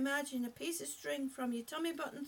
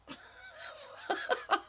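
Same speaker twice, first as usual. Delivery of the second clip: laughing. Real, not acted. Coach at viewer's recliner joke, high wheezy laugh. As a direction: start breathy and build into a real cackle.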